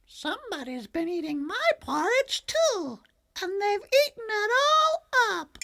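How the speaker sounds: very high, squeaky little cub; tiny baby bear crying out. A narrator's reading. Baby Bear discovers his empty bowl, very high squeaky cub voice. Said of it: "Then Baby Bear cried".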